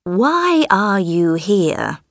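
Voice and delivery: put-on slow, solemn old man. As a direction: speak slowly like an ancient elder. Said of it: "said the old man".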